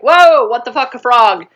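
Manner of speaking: loud, high startled reaction; mock shock. Retelling Tiana finding a frog, mock shock. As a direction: act out being startled, playing it up.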